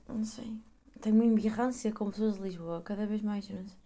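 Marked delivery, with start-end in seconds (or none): 0.1-0.5 whisper. Real, not acted. Trails off.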